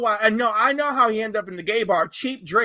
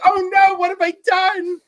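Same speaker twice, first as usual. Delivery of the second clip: much higher and louder mock dismay; mock horror; 8 semitones higher, 7 dB louder than usual. Waking to an open shopping page, hammed-up dismay. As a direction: cry out in hammed-up regret. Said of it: "and you're like".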